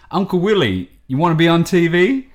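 playfully hollering to an uncle; phone call pitch. Retold or imitated. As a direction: act out an eager invitation.

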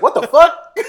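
very high, loud squawk; outraged disbelief. Retold or imitated. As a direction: burst out incredulous, close to laughter. Outraged at being hung up on.

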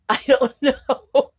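drawn out through a laugh; amused, stretched-out reply. Real, not acted. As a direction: laugh out the words slowly and loudly. Co-host laughs at lost train of thought.

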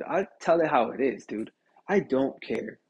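ordinary talk

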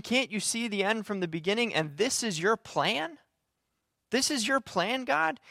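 high, incredulous questioning, rising at the end; challenging disbelief. Acted. Prophet challenges God like a kid to parents, incredulous. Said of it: "a kid saying to his parents".

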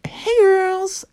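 creepy greeting. Retold or imitated. Mart sneaks up on them.

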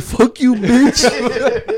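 high-pitched mock cursing; imitated angry outburst. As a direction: imitate an indignant friend, half laughing. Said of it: "Mark started his fucking life. He's like".

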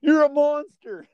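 high, loud, slow mock accusation; mock outrage. Laughing at his friend's outrage, high mock shriek.

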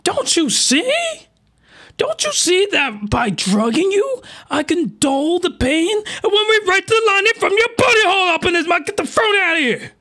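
high pleading voice, slowed at first; hammed-up high girlish plea; mock female villain voice. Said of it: "So she's like".

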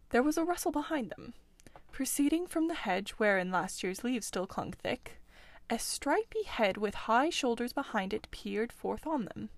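ordinary delivery, nothing marked

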